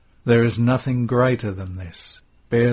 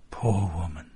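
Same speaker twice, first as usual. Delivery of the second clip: sighing; 4 semitones lower, 4 dB quieter than usual. A narrator's reading. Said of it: "says with a sigh".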